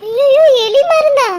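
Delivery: loud, high, stretched-out gasp of words; shocked exclamation. Acted. Friend learns it was rat poison, shocked exclamation. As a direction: cry out slowly in horror.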